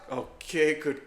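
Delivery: usual speaking voice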